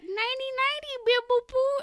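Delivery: put-on high squeaky baby talk; cute baby voice. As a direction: coo it like a tiny creature. Cute goodnight between puffballs.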